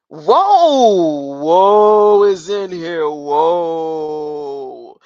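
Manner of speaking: loud drawn-out startled whoa; big amazed whoa, slow and loud. Real, not acted. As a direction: let it burst out in surprise, then stretch it slowly. Startled by something on screen.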